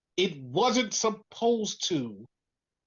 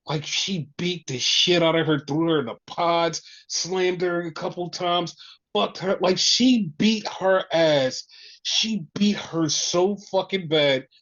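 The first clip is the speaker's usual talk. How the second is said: ordinary talk, nothing marked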